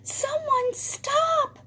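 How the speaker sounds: very high, thin plea; acted high cry for help; distressed cry. A narrator's reading. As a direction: call out slowly in a little quail's high voice. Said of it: "cries Quincy".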